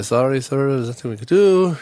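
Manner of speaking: put-on light Jamaican accent on the agent's line. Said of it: "it's my J-, Jamaican accent".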